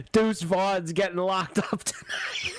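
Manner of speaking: mocking text quote. Host reading co-host's failed prediction back, mocking. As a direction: read the co-host's text back slowly, rubbing it in. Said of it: "you texted me, ... and you said".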